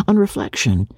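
ordinary delivery, nothing marked

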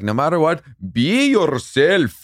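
put-on dad's silly accent. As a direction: play the goofy father handing out wisdom. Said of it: "I don't know what accent it is".

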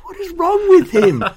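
very high, loud, drawn-out mock outrage; outraged disbelief. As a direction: squawk like a scandalized crowd, slowly. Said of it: "everyone will be like".

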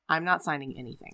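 low, quiet, dead-serious refusal; imitating a wife turned cold; abruptly cold refusal. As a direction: drop the pleading and refuse quietly. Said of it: "she just totally switches".

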